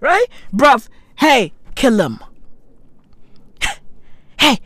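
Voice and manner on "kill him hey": ordinary talk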